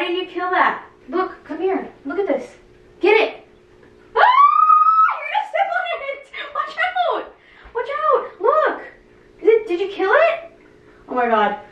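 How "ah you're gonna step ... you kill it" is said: squeamish panic. Real, not acted. Panicking over cricket.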